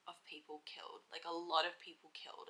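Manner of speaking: whispered, heavy with sorrow; sad whisper. Real, not acted. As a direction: let the words sink into a hushed whisper. Sad thought about war dead.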